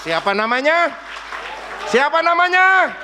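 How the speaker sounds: loud, high rallying shout; full-force preaching. Real, not acted. Preacher calling the congregation to applaud Yesus Kristus, full-force preaching. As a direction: proclaim it at the top of your voice.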